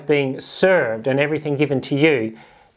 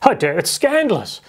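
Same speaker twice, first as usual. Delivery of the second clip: mock fainting-fit outrage; hammed-up indignant swoon; scandalized; 4 semitones higher than usual. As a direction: gasp it out as if scandalized, higher pitched. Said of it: "they're all having fainting fits".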